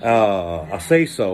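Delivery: ordinary delivery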